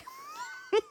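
a short squealing laugh; high burst of laughter. Real, not acted. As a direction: break into a high giggle. Laughs at crude phrase.

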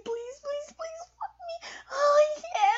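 high, loud cries breaking into a shout; crying shout. Acted. Sub begging master at the peak, crying out high and loud. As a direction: let the plea break into a cry.

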